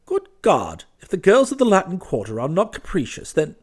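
high, loud protest; indignant outcry. A narrator's reading. Clifford turns the charge on the girls, crying out. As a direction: burst out in his own defence. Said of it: "he cried".

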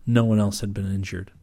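plain reading voice

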